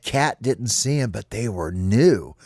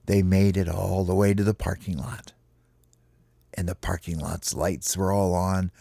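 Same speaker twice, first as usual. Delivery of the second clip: usual speaking voice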